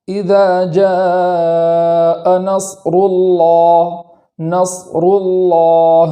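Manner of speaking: slow melodic tajweed chant; Quran recitation. Shown as a demonstration. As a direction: recite the verse slowly, drawing out each word. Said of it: "اس طریقے سے پڑھیں گے".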